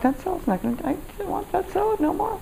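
very high, hushed little squeak; mock small voice. Diner abandoning her salad craving, mock small voice. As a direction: shrink it to a tiny, high mock protest.

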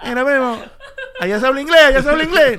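high, loud, laughing cousin; naive cousin voice. Retold or imitated. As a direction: primo ingenuo, agudo y fuerte.

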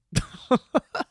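high little burst of laughter; short laugh. Real, not acted. Comic after hookup story, short high laugh. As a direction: laugh at your own punchline.

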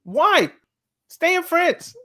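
a frustrated fan's plea; exasperated outburst. Real, not acted. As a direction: throw up your hands in disbelief. Exasperated rant about players leaving.

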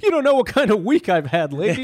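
high, laughing fake exasperation; laughing mock rant. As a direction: vent at the guest through a laugh.

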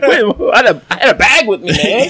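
high, fast, panicky protest; laughing alarm about the forgotten bag; mock panic. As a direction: rush it out in a squeaky panic.

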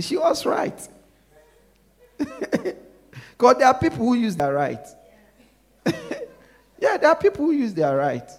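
laughing out loud, pitch high. Real, not acted. Laughing at the child's retort.

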